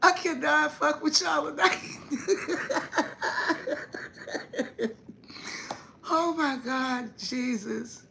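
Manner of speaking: high, squeaky laugh through the words; can barely get it out for laughing. Real, not acted. Cracking up at the chat.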